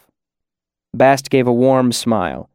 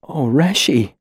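choked up; 3 semitones higher, slower than usual. A narrator's reading. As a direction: voice choking with tears. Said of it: "he choked out".

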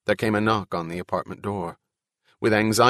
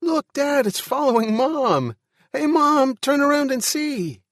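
put-on very high, loud child shouts; delighted boy; 5 dB louder than usual. A narrator's reading. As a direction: call excitedly to Dad and Mom as a little boy. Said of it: "to the delight of the boy".

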